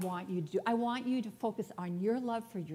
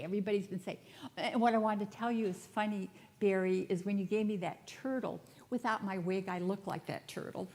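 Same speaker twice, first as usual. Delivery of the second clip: ordinary talk, nothing marked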